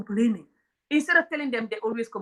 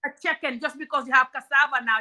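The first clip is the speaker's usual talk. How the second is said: ordinary talk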